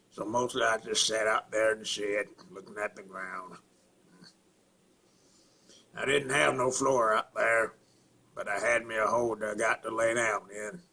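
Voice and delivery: country monologue with halting pauses and grunted mm-hmms; Carl from Sling Blade. Acted. Inmate recounting a killing before his release from the mental institution, in character as Carl. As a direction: put on a rural Southern character voice, pausing between phrases. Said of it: "Billy Bob Thornton's character, Carl".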